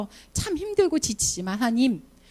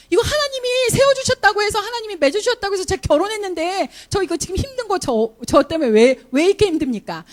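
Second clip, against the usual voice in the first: much higher and louder protest; mock exasperated complaint; 9 semitones higher, 5 dB louder than usual. Wife protesting to God about her hard marriage, raised and loud. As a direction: vent your frustration to God.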